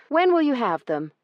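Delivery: high, loud, strained politeness; polite through gritted teeth; forced politeness. Acted. Io holding back her anger toward Branson, forced politeness. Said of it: "she forced herself to ask".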